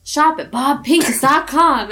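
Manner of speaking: high, bright pitchman voice; ad announcer voice. Retold or imitated. Friends mocking a radio ad, high announcer voice. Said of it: "he'd go".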